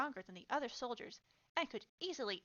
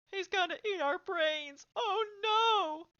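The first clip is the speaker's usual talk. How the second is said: high, loud mock-terrified yell; jeering shout of pretend panic; 8 semitones higher, 10 dB louder, slower than usual. A narrator's reading. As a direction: wail it loudly, rising to a bigger cry. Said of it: "the second soldier yelled".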